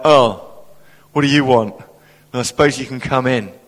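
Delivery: grudging, put-upon welcome; mock begrudging hospitality; unwelcoming. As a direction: imitate a reluctant host letting a visitor in. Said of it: "not the warmest of welcomes".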